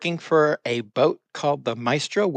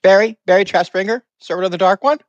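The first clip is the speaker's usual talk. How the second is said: character voice; 5 semitones higher than usual. Acted. Plays Barry in character.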